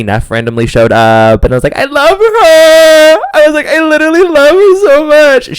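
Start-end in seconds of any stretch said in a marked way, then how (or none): 1.8-5.6 high, loud, stretched-out squeal of adoration; starstruck gushing. Retold or imitated. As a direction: gush like a starstruck fan. Gushing over celebrity sighting.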